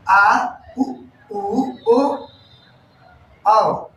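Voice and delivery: उ, ओ, औ sounded out one by one; back vowel demo. Shown as a demonstration. Teacher modelling back vowels for the class. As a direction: give each back vowel on its own. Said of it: "ये हो गया".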